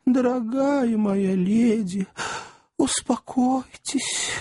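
slow, high, tender pleading; moved, soothing. Acted. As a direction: soothe her, nearly in tears. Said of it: "чуть не до слёз растроганный".

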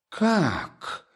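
high, sharp cry out; sudden cry. A narrator's reading. Katerina cries out as Sonya is accused, high cry. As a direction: gasp it out, startled and high. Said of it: "вскрикнула вдруг".

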